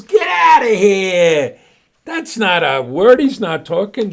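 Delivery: high, loud, slow shouting bartender; angry shout. Acted. Bartender yells at the man and dog, shouting high. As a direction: throw them out with a yell.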